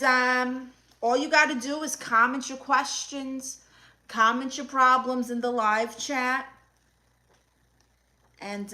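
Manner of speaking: normal talking voice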